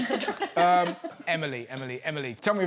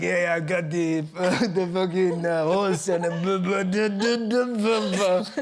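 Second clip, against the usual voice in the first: babbling fake-French accent; mock French accent. Host reimagines the teacher in France, mock French accent. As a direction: run on into French-sounding nonsense syllables. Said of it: "because we're in France".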